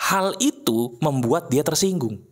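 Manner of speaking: usual speaking voice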